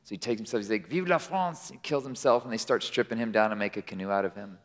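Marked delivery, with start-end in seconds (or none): 0.9-1.7 softly called toast in a put-on French accent; a hint of French bravado in a French accent. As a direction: lightly play a patriotic Frenchman, French accent. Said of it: "the French person says".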